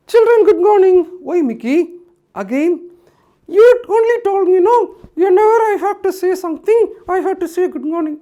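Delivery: high, loud puppet complaining; Mickey puppet voice. Acted. Puppet Mickey reminding the host of his own rule, high and loud. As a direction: argue back like a cheeky puppet.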